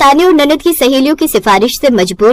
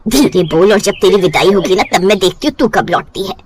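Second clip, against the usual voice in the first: lower, knowing mother's warning; mother warns daughter she'll come running back, lower voice; 6 semitones lower than usual. Acted.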